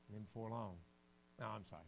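ordinary delivery

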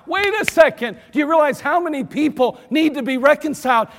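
high, loud urgent challenge; preaching shout. Real, not acted. Preacher rebuking a dismissive congregation, loud and urgent.

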